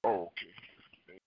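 normal talking voice

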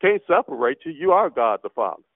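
ordinary talk, nothing marked

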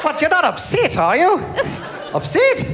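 put-on high, happy New Zealand accent, lilting up; two cheery Kiwis, upbeat whatever the news. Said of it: "still sound happy".

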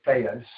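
"Theos" said as THAY-us; common mispronunciation. Shown as a demonstration. Preacher quoting what most people say, "Theos" as THAY-us. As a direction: give "Theos" an AY vowel. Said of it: "Most people say Theos".

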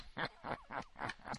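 imitated cackling of the pranksters; put-on giggling fit; mock laughter. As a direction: laugh in mockery, a long rattling run. Mimics staff laughing at prank.

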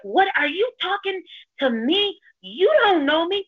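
high, slow confrontational mimicry; retold yelling. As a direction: imitate an affronted stranger squaring up. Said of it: "He's yelling at me".

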